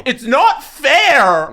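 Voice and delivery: high, slow, drawn-out yell; mock anguished outburst at the TV; retold yell. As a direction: wail it out, stretched and high. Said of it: "is what I yelled".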